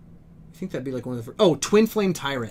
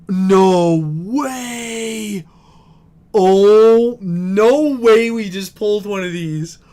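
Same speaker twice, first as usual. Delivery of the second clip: loud, drawn-out amazement; real shock, belting out the vowels; loud and slow, blown away by the luck; stunned disbelief; 4 semitones higher, 8 dB louder, much slower than usual. Real, not acted. Stunned by a very rare card.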